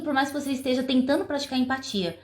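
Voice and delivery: normal talking voice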